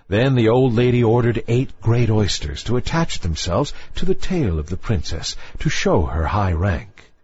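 ordinary delivery

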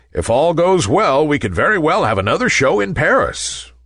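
usual speaking voice

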